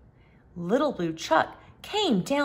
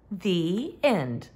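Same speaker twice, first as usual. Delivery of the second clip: ordinary delivery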